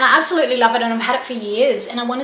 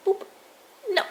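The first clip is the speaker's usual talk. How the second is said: ordinary delivery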